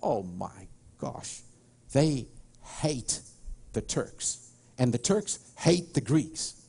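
hushed, slow, dropped in pitch then word by word; grave emphasis. Real, not acted. Speaker tells audience how Greeks hate Turks, gravely emphatic. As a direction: leave a weighty pause around each word.

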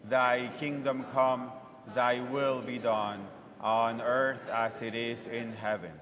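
usual speaking voice

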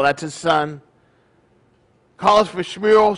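usual speaking voice

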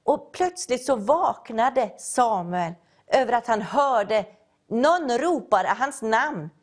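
usual speaking voice

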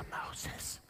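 "Moses" whispered, no voice; a mock whisper of "Moses"; whispered name. Shown as a demonstration. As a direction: breathe "Moses" without voice. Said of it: "He didn't say".

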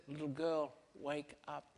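soft, tender wake-up call; Jesus gently rousing the little girl, soft and tender; gentle. Retold or imitated. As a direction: coax the sleeping child awake softly. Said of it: "very gently he says".

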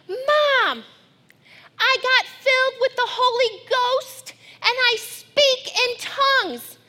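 put-on high, bright child voice bursting with news; excited child. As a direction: gush it out like a thrilled little girl.

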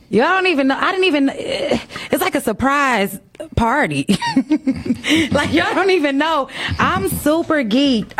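high-pitched excitement bubbling into a laugh; giddy and pitched well up; super geeked. Real, not acted. Host hyping a surprise guest to viewers, super geeked.

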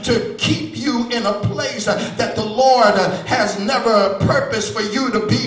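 loud, ringing preaching over the organ; full-force preaching. Acted. Preacher lifting the congregation, in full-force preaching. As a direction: preach it out to the whole church.

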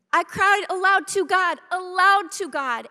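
aloud to God said twice, loud and pressed; emphatic repetition. Shown as a demonstration. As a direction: hammer the repeat: aloud to God, aloud to God. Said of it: "When you say something twice, this is serious".

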